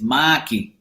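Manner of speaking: Boston-style [name], R dropped; Boston accent. Retold or imitated. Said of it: "In Boston, my name is".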